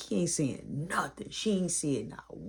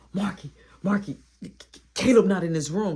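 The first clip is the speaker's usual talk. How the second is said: louder urgent call; panicked alert; 2 semitones higher, 4 dB louder than usual. Retold or imitated. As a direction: shout the news in a panic.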